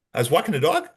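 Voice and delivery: "I was walking the dog" rising like a question; question inflection. Shown as a demonstration. Suspect answering about last night, rising questioning inflection on "dog". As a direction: lift DOG as if asking a question. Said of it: "answered your question with a question mark".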